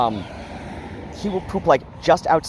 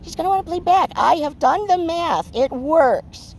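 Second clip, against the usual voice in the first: much higher, squeaky little-guy cat voice; Bran's cat voice; 12 semitones higher than usual. Acted. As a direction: speak as a cocky little cat scheming about Phoebe. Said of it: "Bran's just like this".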